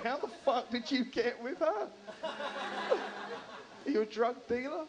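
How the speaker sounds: high, incredulous questioning; hammed-up disbelief; mock incredulity. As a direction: demand an explanation in a high-pitched voice. Comic acts baffled reaction to couple.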